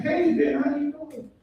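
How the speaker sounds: very high-pitched imitation of a date; date's regular voice. Imitating his date before the drinks, high regular voice. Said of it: "she had a regular accent".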